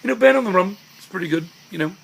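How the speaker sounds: very high-pitched Liverpudlian impression; pretentious Liverpudlian, way up high. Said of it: "I could do a pretty good impression of [name]".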